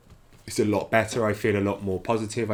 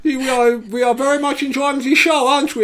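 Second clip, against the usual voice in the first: mock German accent, much higher and louder; 7 dB louder than usual. Teasing partner about their German show, in German accent. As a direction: ham up a German accent to tease her partner. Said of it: "in this accent".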